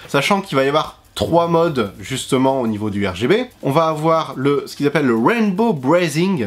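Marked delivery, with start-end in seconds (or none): 5.2-6.5 lightly put-on English accent. Shown as a demonstration. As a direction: show off your English accent a little. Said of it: "l'accent anglais, lâchez un like si mon accent y pète".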